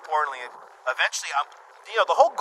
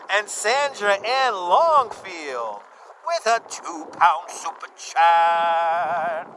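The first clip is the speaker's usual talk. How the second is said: slow, stretched-out host call; announcer drawl; 3 dB louder, much slower than usual. Acted. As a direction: drag it out like a game show host. Drawn-out donor announcement.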